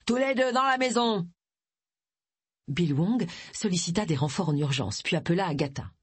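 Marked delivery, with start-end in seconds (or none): 0.1-1.3 high, sustained forceful order; peremptory, raised-pitch order; commanding. A narrator's reading. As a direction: herd captives along with a pitched-up command. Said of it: "ordonna Charlie".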